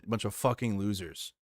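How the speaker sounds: ordinary talk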